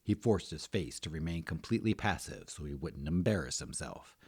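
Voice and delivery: ordinary delivery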